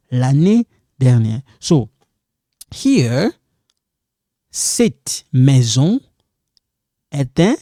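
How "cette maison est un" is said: slow teaching demo. Shown as a demonstration. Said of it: "this one is silent".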